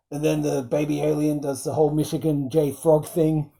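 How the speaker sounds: ordinary talk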